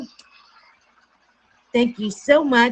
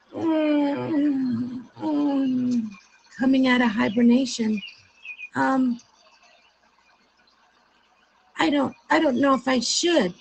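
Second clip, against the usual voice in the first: higher, sleepy unsure duckling; hesitant duckling; 5 semitones higher than usual. Acted. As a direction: mumble out of a long sleep.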